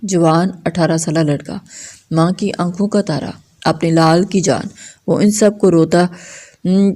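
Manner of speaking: ordinary delivery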